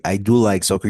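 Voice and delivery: loud, punched DO; stress on do. Shown as a demonstration. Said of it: "in order to give emphasis".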